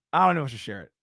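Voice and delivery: normal talking voice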